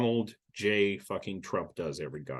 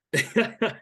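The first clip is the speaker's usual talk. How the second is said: short high laugh; real amused burst, pitched up; 10 semitones higher than usual. Real, not acted. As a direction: let out a high scoffing laugh. Laughs at his own question.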